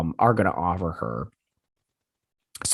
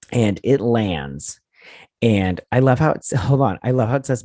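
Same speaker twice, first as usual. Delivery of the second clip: ordinary talk, nothing marked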